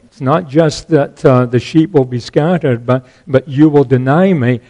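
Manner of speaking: ordinary delivery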